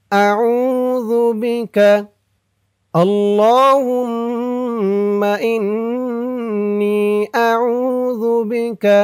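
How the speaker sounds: slow, drawn-out melodic recitation; Quranic recitation. Shown as a demonstration. Teacher reciting the dua for viewers, slow drawn-out chant. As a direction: stretch every vowel in a measured chant. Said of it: "আর আমি যেখানে যেমন টান দিব".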